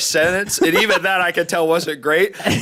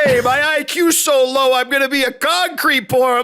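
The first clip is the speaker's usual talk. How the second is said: put-on New Jersey Italian accent; 11 semitones higher than usual. Goofing for friends as an Italian-American guy, New Jersey accent. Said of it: "New Jersey, New Yorker guy".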